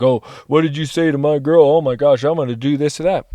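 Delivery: loud, puffed-up tough guy challenge; mock tough guy. Mocking the tough guy confronting someone over his girl. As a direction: bark it like a macho boyfriend. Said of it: "the tough guy, like".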